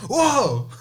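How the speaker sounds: high startled whoa; startled guests. Retold or imitated. Guests flinching at a near punch, high startled whoa. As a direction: jump back in surprise. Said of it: "and they're like".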